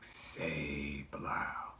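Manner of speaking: very soft, low eerie whisper; whispery entity voice. Retold or imitated. Figure repeats its demand, low whisper. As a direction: stretch it out in a creepy hush. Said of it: "then it was just like".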